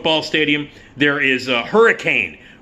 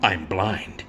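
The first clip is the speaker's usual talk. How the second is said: lower, softer hammed-up reveal; milk the pause, then drop it low and hushed; mock dramatic reveal; 6 semitones lower, 5 dB quieter than usual. Blind husband in the movie, hushed hammed-up reveal. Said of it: "You see".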